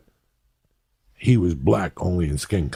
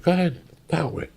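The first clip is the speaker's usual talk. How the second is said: imitating the judge; 12 semitones higher than usual. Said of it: "He's like".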